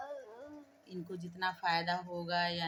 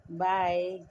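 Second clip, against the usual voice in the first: model bye; 10 dB louder, much slower than usual. Shown as a demonstration. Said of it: "बाय बोल दो".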